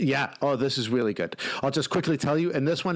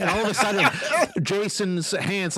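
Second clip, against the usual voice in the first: ordinary talk, nothing marked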